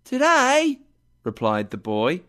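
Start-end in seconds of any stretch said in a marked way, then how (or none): 0.0-0.8 put-on high, surprised boy voice; a boy puzzled by the question. A narrator's reading. Boy answers Scrooge.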